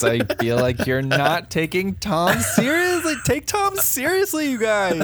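high, whiny pleading; mock earnest plea. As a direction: beg them, hammed-up. Mock pleading to take Tom seriously.

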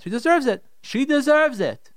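high, insistent protest, slowing on the repeat; indignant complaint. Acted. Imagined husband justifying fights with his wife, indignant. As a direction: dig in harder, stretching the repeat.

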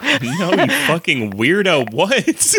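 high-pitched, appalled disbelief; mock incredulous. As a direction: act out a baffled rejection, hammed up. Acting Guy's baffled reaction to murder.

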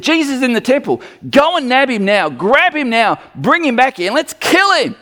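high, urgent mock command; hammed-up, agitated chief priests; priests ordering an arrest.

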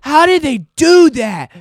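high, loud, slow bafflement; mock baffled yell. People puzzling over the joke, loud and high. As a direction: shout the question like a stunned crowd. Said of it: "people are like".

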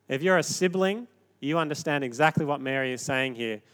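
ordinary talk, nothing marked

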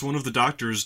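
ordinary delivery

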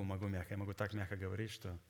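ordinary talk, nothing marked

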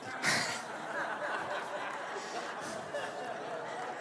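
real breathless giggle he can't stop; helpless laugh. Real, not acted. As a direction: let the laugh slip out uncontrolled. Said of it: "it just got out of me. I couldn't stop it".

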